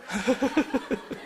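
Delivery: soft, high chuckle; quiet run of chuckles. Real, not acted. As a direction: laugh quietly to yourself for a second. Speaker laughs after audience exchange.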